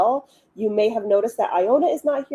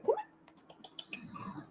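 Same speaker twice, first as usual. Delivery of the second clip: whispering; 19 dB quieter than usual. Real, not acted. Coaxing the dog quietly.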